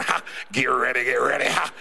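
a playful preacher impression with a punchy "ha!"; T D Jakes. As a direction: ham up a revival preacher getting the crowd ready. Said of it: "watch T D Jakes on there and he gets going".

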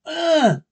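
sudden high shriek; scream. Acted. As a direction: let out a sharp frightened scream. Linda screams as John attacks.

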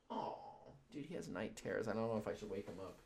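very soft, hushed talk so the dog stays asleep; hushed aside. Real, not acted. To the viewers beside a sleeping dog, hushed and very soft. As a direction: keep it down, barely above a breath. Dog having night terrors nearby.

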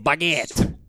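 playful fake French; mock French accent. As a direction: pretend you're French saying baguette. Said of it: "You have to pretend like you're in France".

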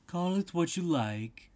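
teasing storybook cat; Cheshire Cat. A narrator's reading. Cheshire Cat answers Alice.

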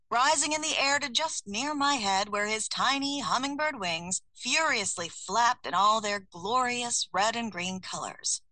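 usual speaking voice

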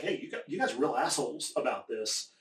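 ordinary talk, nothing marked

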